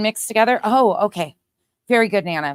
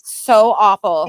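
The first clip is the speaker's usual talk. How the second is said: sarcastic drawn-out echo; 9 dB louder, slower than usual. Acted.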